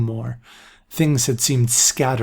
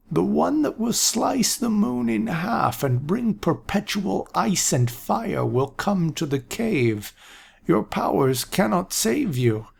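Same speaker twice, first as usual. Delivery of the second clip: put-on higher, slow, grave old man; earnest prophecy; 7 semitones higher than usual. A narrator's reading. Aaron warning Jennifer, slow and earnest. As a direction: deliver the prophecy in dead earnest. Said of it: "his voice grew more earnest".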